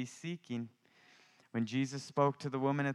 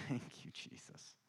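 whispered thanks; 11 dB quieter than usual. Real, not acted. Whispered thanks mid-sermon.

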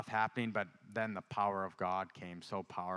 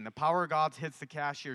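normal talking voice

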